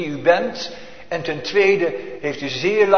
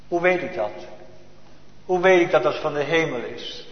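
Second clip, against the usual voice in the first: normal talking voice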